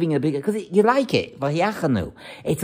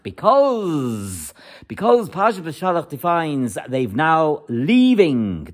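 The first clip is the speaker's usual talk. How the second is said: ordinary delivery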